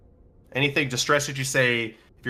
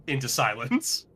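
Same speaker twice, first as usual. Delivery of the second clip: usual speaking voice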